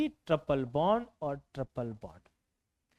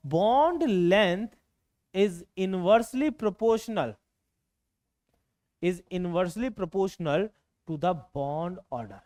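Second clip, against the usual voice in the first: slow dictation; much slower than usual. Shown as a demonstration. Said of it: "teacher dictating rule slowly".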